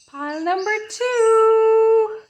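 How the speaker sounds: high, drawn-out singsong call; loud and high, holding the last word long; singsong announcement. Real, not acted. Reader calls up the next pile, high singsong announcement.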